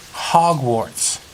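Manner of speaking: usual speaking voice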